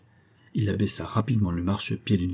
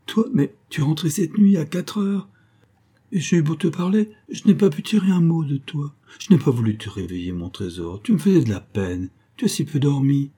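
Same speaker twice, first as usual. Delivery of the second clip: moved, loving, high little voice at first; small tender voice; 6 semitones higher than usual. A narrator's reading. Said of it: "d'une petite voix si émue, si tendre".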